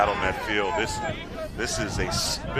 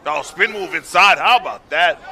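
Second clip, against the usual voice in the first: high, loud excited play call; 6 semitones higher, 10 dB louder than usual. Real, not acted. Announcer calls a spin move.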